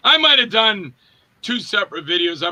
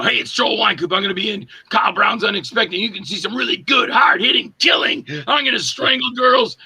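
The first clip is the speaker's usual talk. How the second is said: hammed-up promo pitch; 3 semitones higher than usual. Actor plugging their film in a YouTube gag, hammed-up building pitch. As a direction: pitch rising into an eager shout.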